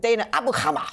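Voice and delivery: "Dana Abu Kamar" said with a throaty Arabic-style h, "Abu Hamar"; laughing, hammed-up Arabic pronunciation of the name; mock BBC Arabic accent. Shown as a demonstration. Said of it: "let me do that in my B B C accent".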